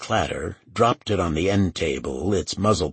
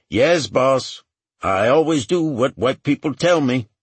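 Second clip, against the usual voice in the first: high, loud sarcastic compliance; loud, pitched-up backtalk to the boss; mock obedience; 9 semitones higher, 6 dB louder than usual. A narrator's reading. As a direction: talk back with exaggerated deference. Ray mocks the order to shut up.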